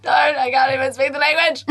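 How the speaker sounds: high, wailing comic plea; hammed-up desperate cry; mock panic. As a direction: imitate your panicked younger self, close to laughing. Retold panic at being spoken to.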